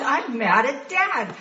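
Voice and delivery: gleeful declaration. Retold or imitated. Gleeful about finally being angry.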